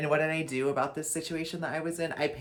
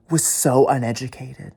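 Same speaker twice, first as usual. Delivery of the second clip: loud; 13 dB louder than usual. Real, not acted. Repeated insult.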